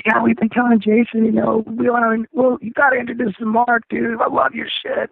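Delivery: high-pitched imitation of keen fans; high, excited retold chatter; eager bandmates. Voicing bandmates keen to meet him.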